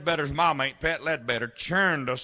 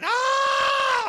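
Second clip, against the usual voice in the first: long, high yell; high-pitched holler held out; excited yell; 4 dB louder than usual. Acted. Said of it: "I heard somebody yelling".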